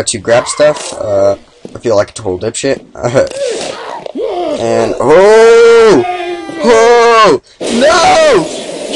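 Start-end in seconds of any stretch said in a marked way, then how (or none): 2.9-4.5 sudden high-pitched laugh. Real, not acted. Laughs at own mistake. 5.0-8.9 high, loud shocked cries; surprised shout. Real, not acted. Gaming fail reaction.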